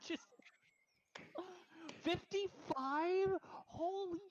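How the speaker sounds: high, slow squeal of disbelief; almost crying in shock; stunned. Real, not acted. Streamer overwhelmed by fifty-five gifted subs, high squeal.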